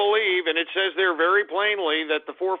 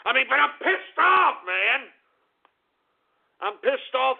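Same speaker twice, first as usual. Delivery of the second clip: venting real anger at the audience; angry rant; 7 semitones higher than usual. Real, not acted. Host rants about being angry.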